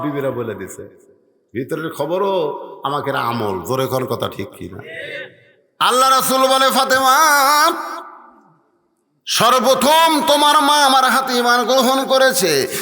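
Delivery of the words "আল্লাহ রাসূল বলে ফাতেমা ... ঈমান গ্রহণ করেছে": high, loud sermon call; full-force preaching. Acted. Preacher voicing the Prophet telling Fatema of her mother, full-force preaching. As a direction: proclaim it high and ringing.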